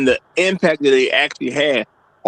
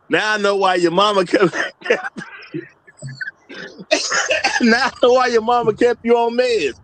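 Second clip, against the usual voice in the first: higher, laughing through it; laughing while reading; 6 semitones higher than usual. Retold or imitated. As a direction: read it, cracking up. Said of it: "He says".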